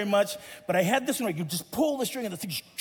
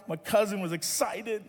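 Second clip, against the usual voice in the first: usual speaking voice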